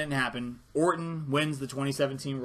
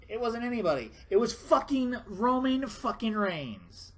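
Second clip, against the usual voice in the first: exasperated rant; 9 semitones higher than usual. Real, not acted. Fan fuming to viewers about Reigns, outraged and slow. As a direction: pitch shoots up, slow and outraged.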